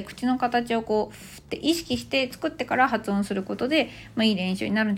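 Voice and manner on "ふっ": shape ふっ as an F, teeth on lower lip; voiceless leaking air on ふっ; English F sound. Shown as a demonstration. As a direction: say ふっ as a pure F without a vowel. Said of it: "単語の最初の発音がFなので".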